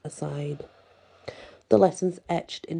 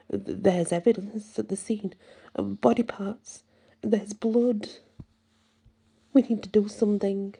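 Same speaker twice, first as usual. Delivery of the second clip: stammering, higher-pitched urgency; halting, shaken plea for action; quivering voice; 4 semitones higher than usual. A narrator's reading. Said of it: "Jason's voice quivered".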